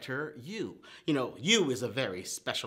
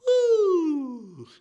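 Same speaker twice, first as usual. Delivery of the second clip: high, loud whoop of delight; excited cheer; excited woo; 13 semitones higher, 8 dB louder than usual. Pretend. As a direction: cheer for the kids. Cheering while tracing the letter.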